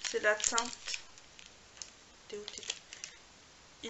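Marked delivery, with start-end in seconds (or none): 2.3-3.9 whisper. Real, not acted. Reading the shade name quietly.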